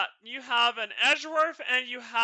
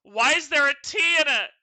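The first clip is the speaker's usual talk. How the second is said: real, loud baffled complaint; loud exasperation; 5 semitones higher, 12 dB louder than usual. Real, not acted. Streamer questions the spelling of Godot, loudly exasperated. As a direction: burst out in disbelief, much louder.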